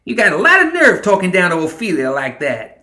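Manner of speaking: high, loud character scolding. A narrator's reading. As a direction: tell him off loudly. Ma scolds Summer after snapping.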